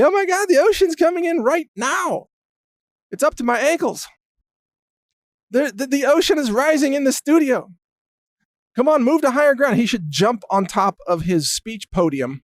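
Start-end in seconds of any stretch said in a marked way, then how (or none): 0.0-9.8 high-pitched, hammed-up alarm; mock panic. Candidate on debate stage as ocean rises, mock panic. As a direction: imitate a candidate panicking at a flood. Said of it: "point to the floor during the debate and say".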